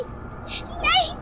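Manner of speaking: very high, frantic pleading cry; terrified scream. A narrator's reading. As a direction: scream and beg for your child's life. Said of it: "मैं जोर से चीखी". (ऊँची, घबराई चीख में गिड़गिड़ाना)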